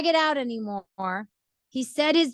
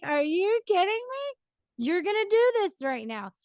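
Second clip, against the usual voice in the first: exasperated husband; 9 semitones higher than usual. Retold or imitated. Husband annoyed she vacuums late, high incredulous. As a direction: voice jumps high in disbelief. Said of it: "and he's like".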